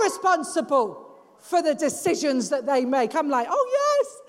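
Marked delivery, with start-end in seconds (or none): 3.5-4.2 high, hammed-up grateful reply; gushing agreement. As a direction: pitch it way up, overdo the thanks. Acted reply to husband's reminder.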